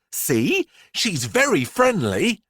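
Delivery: put-on high little-girl voice, coaxing; reassuring Miranda. A narrator's reading. Miranda reassuring the children her python is friendly. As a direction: reassure the nervous crowd. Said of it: "Miranda reassures children".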